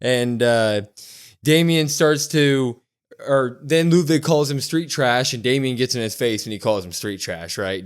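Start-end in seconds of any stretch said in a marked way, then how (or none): none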